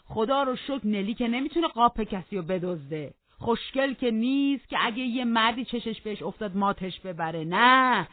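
put-on high, loud quavering old man's complaint; aggrieved old servant. Joseph complains to the master about his garden, loudly aggrieved. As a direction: rant like an offended old servant.

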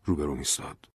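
usual speaking voice

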